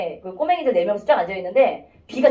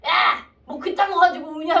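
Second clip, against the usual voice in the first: imitating a kid telling off a crier, pitched up; mock scolding in a higher child's voice; scolding youngest child; 6 semitones higher than usual. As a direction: tell off a crybaby, high and bossy. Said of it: "걔를 막 혼내는 거야".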